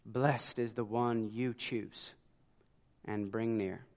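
reverent hush. Shown as a demonstration. David marveling at being chosen, slow reverent hush. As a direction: breathe it out slowly like a prayer. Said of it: "David can say here".

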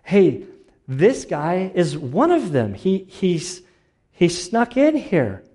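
high, loud, drawn-out accusation; calling for attention. Acted. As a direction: call out slowly to alert the bystanders. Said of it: "trying to get everybody's attention".

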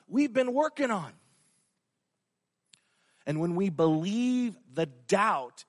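normal talking voice

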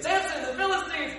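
high, loud hammed-up warning cry; Delilah's staged alarm, high and loud; mock alarm shout. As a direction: yell it like the enemy is at the door. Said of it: "she's like".

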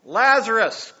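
loud call, falling at the end; shouting a name. Retold or imitated. Jesus calling the dead man from the tomb, a loud shout. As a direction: call him out of the tomb at full voice. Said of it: "Then Jesus shouted".

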